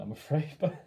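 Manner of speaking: laughing. Real, not acted. Laughing after failing to do a Kiwi accent. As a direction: giggle at your own failed accent.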